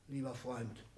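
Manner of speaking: ordinary talk, nothing marked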